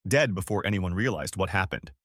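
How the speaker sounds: ordinary delivery, nothing marked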